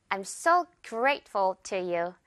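very slow, light ful; slow demo. Shown as a demonstration. Teacher modeling for students to repeat. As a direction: say it slowly and lightly. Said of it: "듣고 따라해 주세요".

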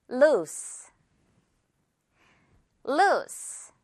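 loose with a clear hissed s for students to copy; model for repeating. Shown as a demonstration. Teacher modelling for learners to repeat, loose with s. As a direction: say loose cleanly and wait for them. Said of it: "듣고 따라해주세요".

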